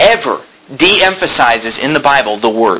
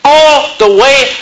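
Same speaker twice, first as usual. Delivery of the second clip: much higher, ringing declaration, stretching "all the way"; God's promise, preached; 10 semitones higher than usual. Acted. Pastor voicing God's vow to the faithful, high and ringing. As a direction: proclaim it as the Lord's own vow, building. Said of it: "He said".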